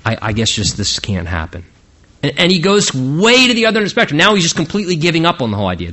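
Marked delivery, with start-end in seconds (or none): 0.0-1.6 quiet, lower, defeated; disheartened. Retold or imitated. David giving up on bringing the ark home, disheartened. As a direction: let it sag into giving up. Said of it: "completely disheartened and, and disappointed".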